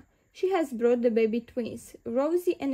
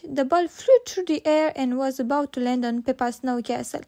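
plain reading voice

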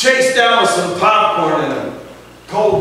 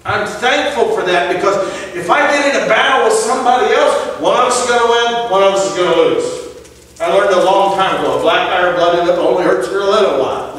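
raised, ringing pulpit delivery; fervent preacher's cadence; preaching at full force; 6 semitones higher than usual. Acted. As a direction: proclaim it to the congregation. Preacher proclaims the gospel story.

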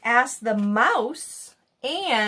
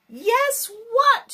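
excited storybook shout; 13 semitones higher than usual. A narrator's reading. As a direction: call it out like a crowd of animals. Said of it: "shouted all the animals".

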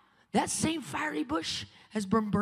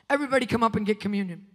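usual speaking voice

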